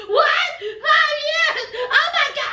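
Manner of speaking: high squealing disbelief; shocked Jenny. Retold or imitated. Jenny hears divorce takes five years, high shocked squeal. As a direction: shriek it like the worst news ever. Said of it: "Here comes Jenny".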